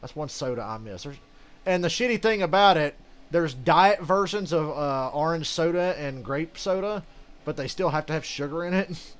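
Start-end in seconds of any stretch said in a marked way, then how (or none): 1.6-9.1 annoyed rant. Real, not acted. Ranting about diet soda.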